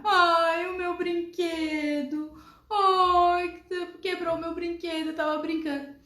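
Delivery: put-on high, slow whiny child's wail; mock child crying. Child wails to mother about broken toy, mock sob. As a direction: play the little girl sobbing over her toy.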